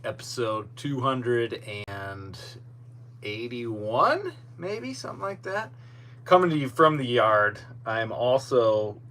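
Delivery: ordinary talk, nothing marked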